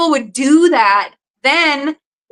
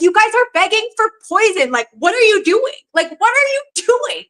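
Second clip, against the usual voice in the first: much higher-pitched, disbelieving protest; incredulous exasperation; 9 semitones higher than usual. Acted. Answering commenters begging for fluoride, incredulous and exasperated. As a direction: plead in high disbelief, pitch climbing at the end.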